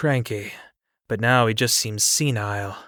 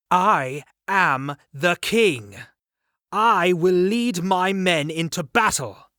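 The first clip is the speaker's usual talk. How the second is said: slow, every word spelled out, higher; enunciating each word; 7 semitones higher, slower than usual. Acted. Arthur insisting to his uncle, each word enunciated slowly. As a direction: bite off each word in frustration. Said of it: "enunciating each word to highlight my frustration".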